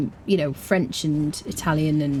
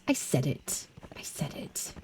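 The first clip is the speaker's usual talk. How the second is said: unvoiced cheeky whisper; 4 semitones lower, 11 dB quieter, slower than usual. Real, not acted. Streamer admits her R complaint to chat, cheeky whisper. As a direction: breathe it like a naughty confession.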